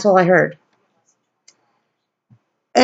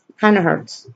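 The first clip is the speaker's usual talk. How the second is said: ordinary talk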